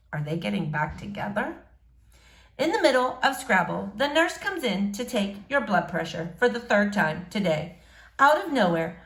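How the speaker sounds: usual speaking voice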